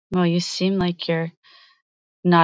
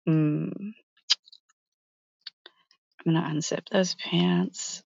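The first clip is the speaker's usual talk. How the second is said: seductive murmur; 4 semitones lower, 6 dB quieter than usual. Acted. Seductive slow low talk.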